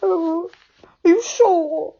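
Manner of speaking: high teary voice, slowly brightening; tearful relief. A narrator's reading. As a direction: sniffle then cheer up. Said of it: "wiping his eyes".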